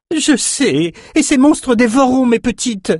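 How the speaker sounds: very high, anxious character voice; distressed old woman. A narrator's reading. Woman fearing soldiers will devour her goats, distressed.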